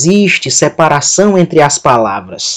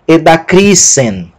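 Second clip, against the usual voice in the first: edacrisen with a long, strong cri; e-da-CRII-sen, stretched third syllable; stress on CRI; slower than usual. Shown as a demonstration. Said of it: "Outro poderia pronunciar".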